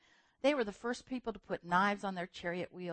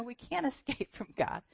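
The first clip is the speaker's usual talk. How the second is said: ordinary talk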